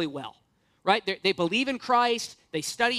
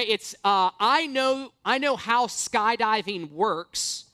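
ordinary talk, nothing marked

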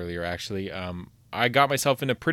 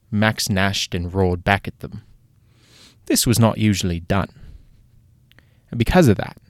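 a lightly put-on British accent, telling a tale; hammed-up British narrator reading to a child; British storyteller; 3 dB louder than usual. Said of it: "I literally haven't been able to do a British accent".

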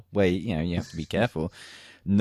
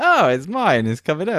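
high, louder startled gasp of discovery; mock surprise; 10 semitones higher, 8 dB louder than usual. Soldier retelling a shower mishap, high mock surprise. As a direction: play up the shock of seeing your own blood. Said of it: "I was like".

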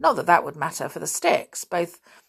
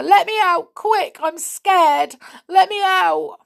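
high, loud, pleading yells; scared yell; 13 semitones higher, 8 dB louder, slower than usual. A narrator's reading. Edgar locked in the cave to Ma, frightened yelling. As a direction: beg to be freed in a panic. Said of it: "yelled Edgar".

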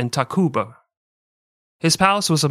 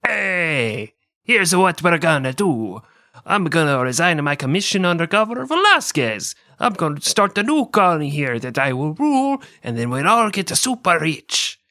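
higher, hammed-up Spanish-accented Cortez; mock Spanish accent; 4 semitones higher than usual. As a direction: pitch a scheme in a put-on conquistador accent.

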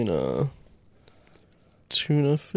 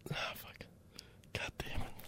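whispered curse; 23 dB quieter, slower than usual. Real, not acted. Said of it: "frustrated after failing".

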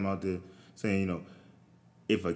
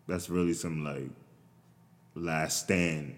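usual speaking voice

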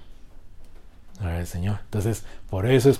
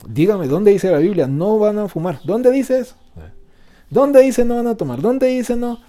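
higher, louder demand, hammed up; mock challenging skeptic; 11 semitones higher, 7 dB louder than usual. Preacher mocks a churchgoer questioning the Bible, loud mock challenge. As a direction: parody a smug objector demanding proof.

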